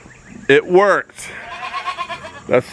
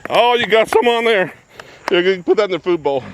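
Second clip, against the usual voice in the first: ordinary delivery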